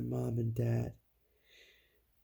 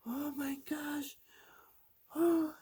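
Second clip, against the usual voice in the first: high, hushed, slow frightened voice; scared gasp; slower than usual. A narrator's reading. Storyteller as brothers shaken by the scream, frightened.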